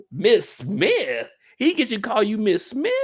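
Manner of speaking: high-pitched scandalized questioning; incredulous. Acted. Commentator can't believe she allows Miss Smith, incredulous. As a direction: raise it in mock disbelief. Acted disbelief at the name.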